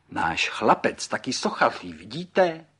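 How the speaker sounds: loud proud boast, lifting at the end; exclaiming proudly. A narrator's reading. Father shows the guest his son's sculpture, exclaiming proudly. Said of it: "zvolal a srpem zaťukal".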